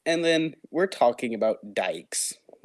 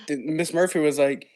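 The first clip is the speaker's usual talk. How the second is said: normal talking voice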